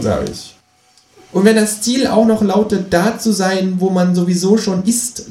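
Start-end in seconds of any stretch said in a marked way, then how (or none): 1.3-5.3 high, cheeky kangaroo voice. Acted. Reading aloud, the narrator voices the Kangaroo asking him to pay, high and cheeky. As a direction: voice the Kangaroo a notch higher. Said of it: "lästert das Känguru".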